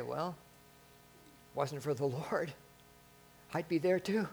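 soft, gentle answer with a smile; gentle smiling reply. Retold or imitated. To a curious coworker, a gentle soft reply. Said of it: "gently smile and say".

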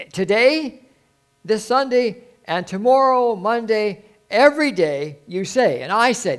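high, loud pulpit proclamation; rousing preaching. Acted. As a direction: ring out each item, with pauses between. Preaches at full force.

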